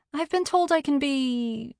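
hesitant, suspended phrase; searching for the word. A narrator's reading. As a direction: leave it hanging while hunting for a word. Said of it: "searching for the word".

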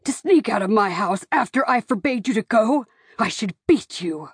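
enraged father scolding; threatening paternal tirade; angry shouting. A narrator's reading. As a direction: berate a disobedient daughter in a hard voice. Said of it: "he shouted".

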